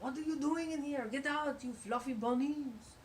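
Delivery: gruff shouting chef; angry chef. Retold or imitated. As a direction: shoo the bunnies out. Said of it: "the chef's like".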